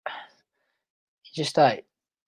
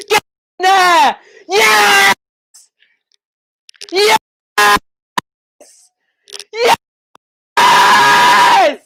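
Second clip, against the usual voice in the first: high, loud, real celebration shouting; ecstatic goal scream; 13 semitones higher, 6 dB louder, slower than usual. Real, not acted. Fan watching Charlie Austin score on his return, ecstatic screaming. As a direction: scream it out, stretching the cries.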